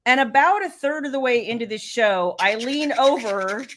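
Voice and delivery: ordinary delivery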